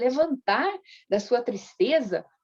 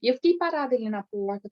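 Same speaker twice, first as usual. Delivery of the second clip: normal talking voice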